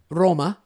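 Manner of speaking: Roma said the Italian way. Retold or imitated. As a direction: say Roma like a local in Rome. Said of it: "or as they say in Rome".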